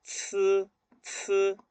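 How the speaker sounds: "ts" with a strong puff of air; aspirated, held "ts" for the C; spitting out air. Shown as a demonstration. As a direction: spit the air out on each "ts". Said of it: "La C va a escupir aire".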